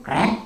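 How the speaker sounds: a sharp, loud mimicked krek of cloth tearing; ripping sound. Imitating the robe catching on wire, loud krek. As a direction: spit out the krek like a seam ripping.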